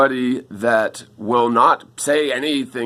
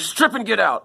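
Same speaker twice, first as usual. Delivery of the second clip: retold yell; 8 semitones higher than usual. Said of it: "he was yelling".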